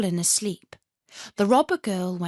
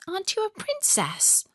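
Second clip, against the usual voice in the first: put-on curious girl; 12 semitones higher than usual. Robber girl asks Gerda.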